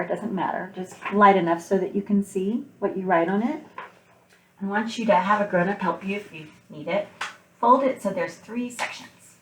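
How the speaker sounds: usual speaking voice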